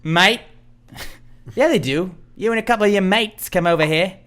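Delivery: put-on laying on the London accent for the soccer fans; mock London accent. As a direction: play a cheeky Londoner. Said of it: "You sound like you're from London".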